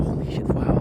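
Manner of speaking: hushed, amazed whisper; awed whisper. Retold or imitated. Recalling first seeing the sight, awed whisper. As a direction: breathe it out in awe. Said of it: "I was like".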